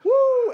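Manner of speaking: shrill high woo; Ric Flair woo. Retold or imitated. Retelling the Rogan bit, a high whooping woo. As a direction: whoop it out like the Nature Boy. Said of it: "He goes".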